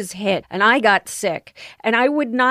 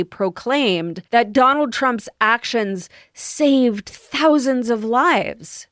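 normal talking voice

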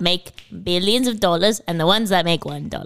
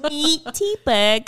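put-on New Zealand accent; 7 semitones higher than usual. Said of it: "you have to make your mouth tinier".

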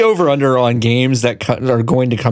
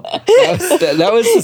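laughing. Real, not acted. Laughing reaction to voice.

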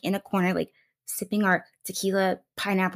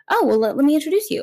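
high, loud, fast offer; eager friend; 8 semitones higher, 5 dB louder, much faster than usual. Retold or imitated. Friend offering an introduction, high eager rush. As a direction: imitate a friend jumping in eagerly.

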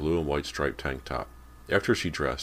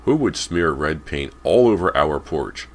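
loud, slow, indignant demand; 9 dB louder than usual. A narrator's reading. Father finding paint on his porch, demanding. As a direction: demand it loudly, weighting each word. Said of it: "Callie's father demanded".

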